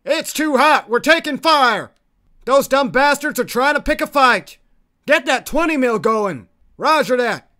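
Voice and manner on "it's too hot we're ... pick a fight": raised, higher-pitched soldier's call; combat shout. Acted. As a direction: call out that you're under fire. Soldier under enemy fire.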